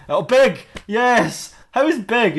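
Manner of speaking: high-pitched, excited remembering; sudden recollection. Acted. Suddenly remembers a name, acted.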